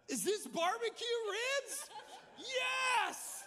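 thrilled to get ribs on stage; real excited shout. Real, not acted. Pastor handed ribs mid-sermon, shouting with joy. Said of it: "I'm so excited".

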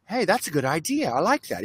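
soft light suggestion; subtle suggestion. Retold or imitated. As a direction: offer it softly, barely there. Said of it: "subtle suggestion".

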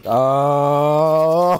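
long, level, drawn-out "oh"; long gasped "oh". Real, not acted. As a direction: hold the "oh" as it dawns on you. Long reaction to the reveal.

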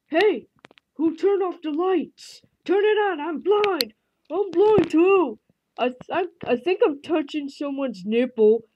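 high-pitched panicking figures; squeaky figure voices. Pretend. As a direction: pipe it up high like a startled toy. Voicing figures in dark.

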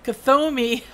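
loud, high and amused; playful loud call. Pretend. Calling out misread name playfully.

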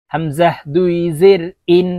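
হামজাহ recited evenly, ইন with a clean glottal start; clean hamza. Shown as a demonstration. Teacher modelling for learners, হামজাহ then a plain glottal ইন. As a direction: start ইন crisply on the bare vowel. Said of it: "চারটি হরফের উচ্চারণ চারভাবে হবে".

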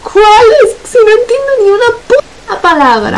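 high, whiny half-crying complaint; mock despairing whine. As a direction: wail it like it's hopeless, hamming it up. Despairing over French pronunciation.

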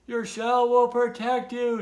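high, loud storybook call; shouted reassurance. A narrator's reading. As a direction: holler encouragement from below. Turtles shouting reassurance up to Tudley.

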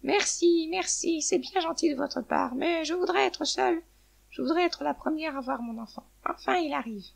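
high-pitched hen voice; grateful hen. A narrator's reading. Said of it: "La poule dit".

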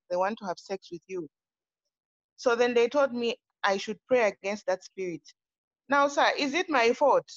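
ordinary delivery

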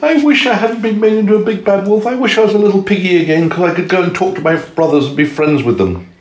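high, wistful piggy voice; sad wolf-pig. A narrator's reading.

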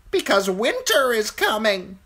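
high, loud eerie crow voice. A narrator's reading. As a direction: caw it out like an uncanny bird. Crow's voice.

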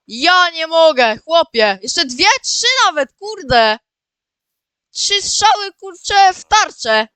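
loud, high-pitched disbelief; amazed excitement. Real, not acted. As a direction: exclaim as if you can't believe your luck. Amazed by three hits in target.

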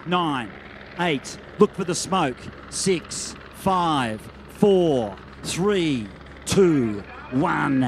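low, steady countdown to the gun; announcer counting down the starting gun; race countdown. Real, not acted.

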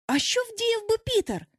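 high-pitched eager question; boys asking together. A narrator's reading. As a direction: ask as a troop of young boys would. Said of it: "запитали всі разом". (хлоп'ячий високий запитальний голос)

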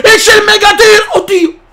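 high excited call; excited commentary. Real, not acted. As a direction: call the shot.

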